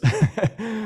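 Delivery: short high laugh; burst of laughter. Real, not acted. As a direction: laugh it off, amused at yourself. Laughs at own lost thread.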